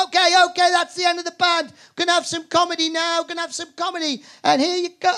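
high, loud announcer voice introducing the comedy; pub compere. Retold or imitated. Irish pub host announcing the comic, high and loud announcer voice. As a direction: whip up the crowd like a pub MC. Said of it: "he goes".

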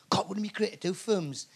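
wistful thought under the breath; whispered daydream. Acted. Everybody dreaming aloud of a film career, whispered. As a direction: whisper it like a private wish.

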